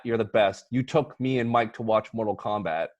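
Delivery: ordinary talk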